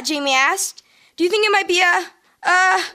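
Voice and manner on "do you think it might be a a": climbing higher and louder with each stammered a; breathless, stammering little kid guessing wildly; small child gasping out a wild guess to dad; excited kid. Acted. Kid guesses what hit bus.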